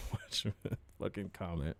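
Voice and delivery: whispered warning through a laugh; soft mock-menacing whisper. Real, not acted. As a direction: hush it as a joking threat. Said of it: "scolding a chat commenter".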